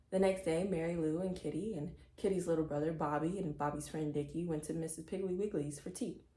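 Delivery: ordinary delivery, nothing marked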